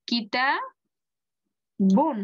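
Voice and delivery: কিতাবুন stretched out as "kitaa... bun"; slow model pronunciation. Shown as a demonstration. Teacher models it for students to say, slow and split. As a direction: sound out কিতাবুন very slowly in two parts. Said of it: "এখন বলেন".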